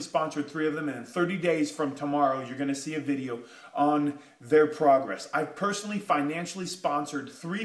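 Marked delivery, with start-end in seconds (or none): none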